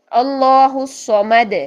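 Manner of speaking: slow, drawn-out Quran recitation. Shown as a demonstration. Teacher reciting Surah Ikhlas as an example. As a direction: recite with the dal echoing. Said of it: "دال کو ہلانا ہے".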